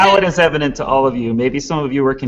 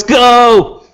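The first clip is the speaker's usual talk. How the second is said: higher, louder announcer shout; 1920s announcer cue; 10 semitones higher, 6 dB louder than usual. Pretend. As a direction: belt it out like an old-time announcer. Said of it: "in a nineteen twenties announcer voice".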